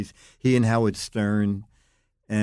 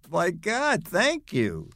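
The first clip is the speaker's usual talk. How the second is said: retold grateful amazement; 7 semitones higher, slower than usual. Quoting own thrilled reaction to upgrade.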